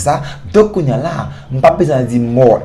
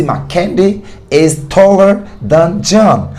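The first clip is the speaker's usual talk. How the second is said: slow, careful model of the right English; slow correct model; 5 semitones higher, 3 dB louder, slower than usual. Shown as a demonstration. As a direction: set out the correct form slowly. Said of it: "M'a pas dit".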